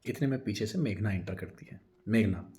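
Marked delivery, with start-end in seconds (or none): none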